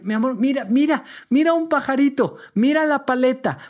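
put-on high coaxing baby talk to a crying child; sweetly distract the child, pitch lifted high; coaxing grandma. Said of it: "le decían".

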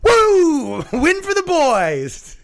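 high, drawn-out cheer. Real, not acted. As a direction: crow it like a victory whoop. Celebrating a win, cheering.

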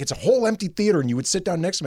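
put-on fast, high, exasperated old lady; indignant old lady. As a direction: rattle off the complaint like an offended old lady. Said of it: "she's like".

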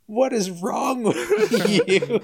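slow, high, laughing disbelief; mock the friend through laughter; roommates laughing. Roommates in the car, laughing at the panicking friend. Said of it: "And they're like".

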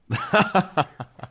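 high laughing burst; real laughter at the punchline. Real, not acted. Host laughing at Morgan's comeback, real laugh.

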